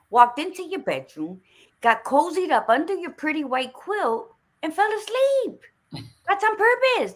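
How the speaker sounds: high-pitched, building retold argument; insistent arguing. Aunt disputing niece's claim of accidental sleep, insistently arguing. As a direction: argue the case, pitch climbing to a peak.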